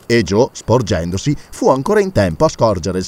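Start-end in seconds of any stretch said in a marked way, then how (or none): none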